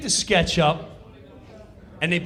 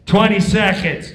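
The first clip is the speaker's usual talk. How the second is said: loud countdown shout; countdown call; 4 semitones higher, 11 dB louder than usual. Retold or imitated. Stage manager counting down, loud call. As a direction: bark the time left.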